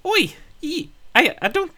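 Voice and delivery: put-on high, startled Brooklyn kid; Brooklyn accent. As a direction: pop out squeaky like a Brooklyn kid. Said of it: "he's like".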